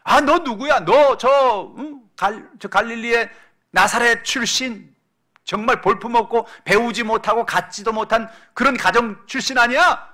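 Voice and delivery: loud, high-pitched scornful questioning; mocking jeer. Crowd scoffing at Jesus over his Nazareth origins, mocking jeer. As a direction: jeer at him like a hostile crowd. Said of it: "막 조롱하는 거예요".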